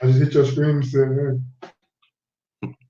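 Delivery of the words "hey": ordinary talk, nothing marked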